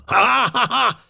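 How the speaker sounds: put-on very high old man's chuckle; chuckling. A narrator's reading. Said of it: "kuncogott az öreg úr". (magas hangú öreges kuncogás)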